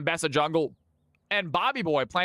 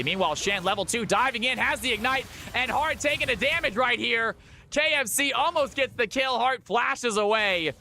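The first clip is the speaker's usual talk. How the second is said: live play-by-play; 6 semitones higher than usual. Acted.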